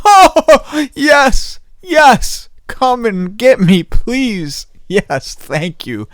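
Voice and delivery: high pleading cries, easing off; desperate relief. Retold or imitated. Inwardly begging his friend to come, relieved wailing. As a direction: wail gratefully, then slow into thanks. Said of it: "But in my mind, I was like".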